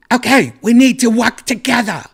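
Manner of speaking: high, loud British scolding; angry British woman. Retold or imitated. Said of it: "angry at you".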